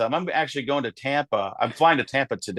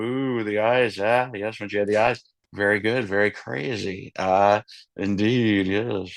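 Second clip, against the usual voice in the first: lower, halting Goldblum musing; Jeff Goldblum; 4 semitones lower than usual. Retold or imitated. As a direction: savour every pause like Goldblum. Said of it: "with the Jeff Goldblum".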